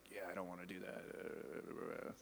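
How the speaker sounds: low grumble under the breath, trailing into mumbles; demonstrated grumbling. Shown as a demonstration. Preacher shows his teenage self walking away, grumbling. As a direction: mumble it softly and slowly, low in the throat. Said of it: "kinda grumbling like this".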